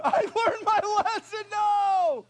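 very high, loud slow wail; wailing kid. Retold or imitated. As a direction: cry out like a begging child. Boy wailing to mother.

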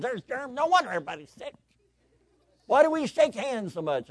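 high, loud mock tirade; imitated indignant rant. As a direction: rail like a fed-up complainer, hammed up. Acted germ rant by one guy.

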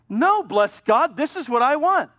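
loud, slow and high stubborn protest; defiant insistence. Acted. Preacher mocks a willful Paul defying God, loud and slow. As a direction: dig in your heels, loud.

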